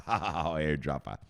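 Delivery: ordinary talk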